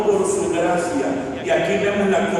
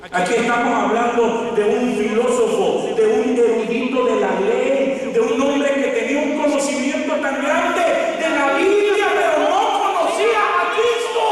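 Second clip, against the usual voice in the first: preaching, building; 7 semitones higher than usual. Real, not acted. Preacher on a scholar without Christ, preaching rising to a shout. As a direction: climb steadily to a high, full-force cry.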